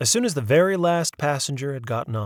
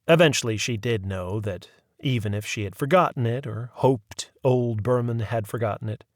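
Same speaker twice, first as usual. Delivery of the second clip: usual speaking voice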